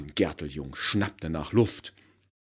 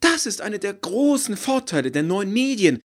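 high, loud, thrilled pitch; eager marketing woman, high and loud; enthusiastic exclaiming; 12 dB louder than usual. Acted. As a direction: sell it with beaming enthusiasm. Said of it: "rief von Gerteljung begeistert".

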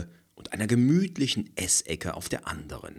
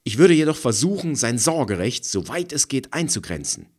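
heated; 8 semitones higher, 8 dB louder, faster than usual. A narrator's reading. Threatens custody.

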